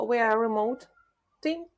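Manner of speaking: ordinary talk, nothing marked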